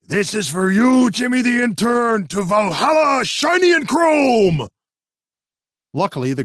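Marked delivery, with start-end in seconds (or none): 0.0-4.7 high, slow fanatic shout; crazed battle cry; war cry. Acted. Maniac driver battle cry.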